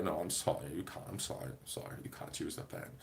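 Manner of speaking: very quiet, low, half-whispered apology; apologetic Brit. Retold or imitated. Brit refusing to lend a pen, softly apologetic. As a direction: imitate a meek British refusal, trailing into near-silence. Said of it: "and a Brit would say".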